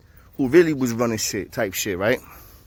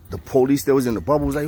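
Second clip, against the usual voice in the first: plain reading voice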